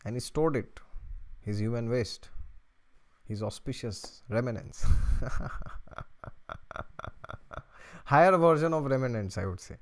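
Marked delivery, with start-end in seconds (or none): 4.9-8.0 long run of chuckles; real giggling laugh. Real, not acted. Host amused by own euphemism, chuckling at length.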